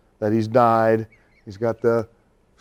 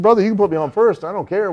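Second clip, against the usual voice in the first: relieved; 9 semitones higher, 5 dB louder than usual. Retold or imitated. Relieved reply in retold story.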